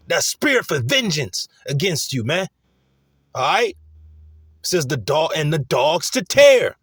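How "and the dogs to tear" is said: "And the dogs to tear" slow and louder, weight on tear; slow emphatic reading. Shown as a demonstration. Preacher rereading the scripture to the crowd, slow and emphatic. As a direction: spell out each word of the verse.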